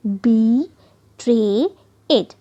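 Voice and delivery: बी, ट्रे, इट broken into three beats; syllables split apart. Shown as a demonstration. Teacher splitting the word into syllables for students, slow and separate. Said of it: "यहाँ बी ई sound आ रहा है".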